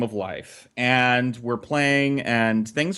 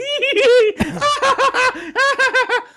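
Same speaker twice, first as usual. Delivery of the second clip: mock girly giggle; 6 dB louder than usual. Giggling siblings mimicked.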